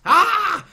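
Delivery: high loud mock scream; cartoon villain fleeing in panic. Bad Panthro runs away, mock scream.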